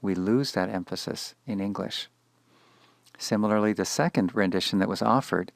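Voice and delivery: ordinary delivery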